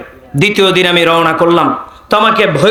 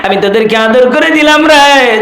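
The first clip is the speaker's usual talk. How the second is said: tearful-style chanted storytelling; imitated preacher's melodic wail; Tofazzol Bhairabi impression. Shown as a demonstration. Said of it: "ওনার মতো হুবহু হয়েছে তো".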